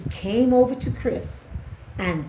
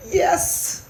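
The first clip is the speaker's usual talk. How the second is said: small, high mouse voice; weak; 10 semitones higher, much slower than usual. A narrator's reading. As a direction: squeak a weak admission. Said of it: "said Christopher weakly".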